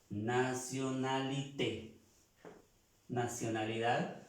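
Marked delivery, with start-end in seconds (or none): 0.1-2.0 "Nationalité" said slowly in French, stress on the final té; French pronunciation. Shown as a demonstration. Teacher showing students the French word, slow and careful. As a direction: spell out "Nationalité" syllable by syllable, landing on té. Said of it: "se pronuncia la E".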